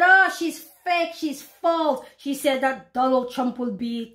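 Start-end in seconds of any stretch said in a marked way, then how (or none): 0.0-2.0 mocking doubter. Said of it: "people have said".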